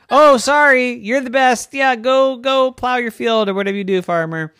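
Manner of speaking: high, loud, fast sass; cheeky piglet. Acted. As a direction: brush the farmer off like a cheeky pig. Pig sassing the farmer.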